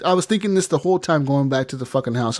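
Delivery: usual speaking voice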